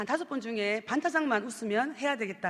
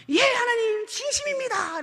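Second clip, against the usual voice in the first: pleading; 10 semitones higher, 4 dB louder than usual. Retold or imitated. Man swears sincerity.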